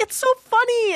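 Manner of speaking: high, tickled dad voice; amused dad imitation. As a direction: pitch it way up as delighted dad.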